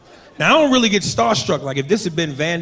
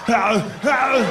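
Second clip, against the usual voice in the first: drawn-out mock groan; two long, put-on howls; imitated movie grunt; 3 semitones higher than usual. Covering a fart in an elevator, mock groaning. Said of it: "I like to make that noise".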